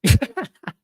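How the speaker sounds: loud high burst of laughter. Real, not acted. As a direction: crack up at the chat. Laughing at chat comments.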